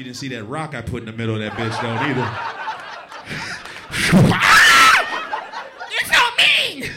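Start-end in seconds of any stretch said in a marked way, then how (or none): 2.4-5.9 long, loud falsetto wail; mock pained scream. Acting girl hit by snowball. 5.9-6.9 loud, drawn-out falsetto wail; annoying girl voice. Retold or imitated. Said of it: "That voice is annoying".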